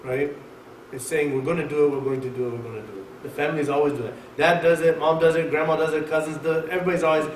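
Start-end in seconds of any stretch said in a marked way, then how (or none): 1.3-3.2 low, fading mock promise; mock empty promise. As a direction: imitate a half-hearted promise, trailing away softly. Mimics family endlessly putting things off.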